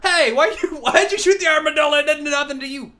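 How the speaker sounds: high, loud comic protest; hammed-up indignant yell; mock indignant scolding. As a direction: holler at the shooter in mock outrage. Scolds shooter in comic role.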